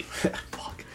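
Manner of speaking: unvoiced whispered swear under breath; whispered curse. Real, not acted. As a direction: whisper it so the customer can't hear. Flustered aside during mock sales call.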